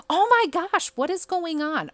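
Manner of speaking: high, loud oh my gosh at the glitch; exasperated surprise. Real, not acted. Gamer baffled as her decor keeps vanishing, loud and high. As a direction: yelp it out, baffled and fed up. Game keeps deleting wrong item.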